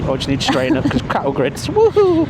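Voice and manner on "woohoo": high, loud whoop of joy; excited whoop. Real, not acted. Rider whooping over the cattle grids, thrilled. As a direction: whoop it out as you ride.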